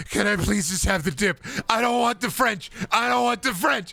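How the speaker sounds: very high, whiny pleading; pathetic begging. Pretend. Customer begging Arby's staff for just the dip, high whiny plea. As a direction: beg like a desperate little child.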